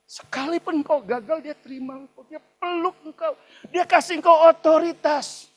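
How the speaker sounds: high-pitched, building sermon climax; impassioned preacher, loud at the peaks; fervent preaching. Real, not acted. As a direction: proclaim acceptance with mounting force. Preacher climaxes on unconditional acceptance.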